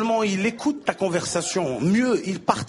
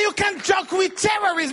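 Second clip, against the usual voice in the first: angry guard shout; 11 semitones higher than usual. Acted. Airport guard scolding the comic, high stern shout. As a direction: bark the rule at him.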